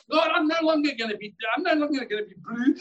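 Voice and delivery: high and fast, resolute; determined rush. Retold or imitated. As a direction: rattle off the vow quickly. Retelling his resolute prayer.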